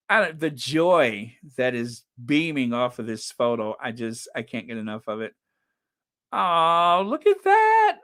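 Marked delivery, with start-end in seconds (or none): 6.3-8.0 high, loud gushing delight; delighted squeal. Real, not acted. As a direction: squeal with joy at a sweet photo. Delighted reaction to family photo.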